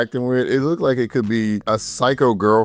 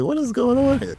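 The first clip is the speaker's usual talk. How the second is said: high, disbelieving squeal of a question; incredulous. Retold or imitated. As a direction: react to something absurd. Mocking the Russian character's look.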